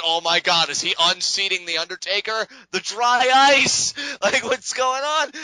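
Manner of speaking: high, building excited outburst; jazzed up. Retold or imitated. Fan reliving the smoky Wyatt promo, jazzed up. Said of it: "got me so jazzed up".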